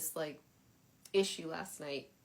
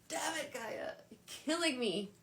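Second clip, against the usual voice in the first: high-pitched playful complaint; mock exasperation; 7 semitones higher than usual. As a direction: groan at her in comic defeat. Retold exasperation at the card.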